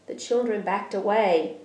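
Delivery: plain reading voice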